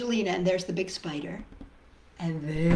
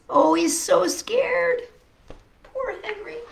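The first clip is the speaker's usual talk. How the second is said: sympathetic; 12 semitones higher, 6 dB louder than usual. A narrator's reading. Reader sympathising with scared Henry.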